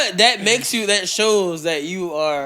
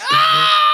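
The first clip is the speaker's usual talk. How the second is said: sudden high shriek, louder; shriek of laughter; 5 dB louder than usual. Retold or imitated. Friends in the audience crack up at the drawers, high laughing shriek. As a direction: let out a laughing scream.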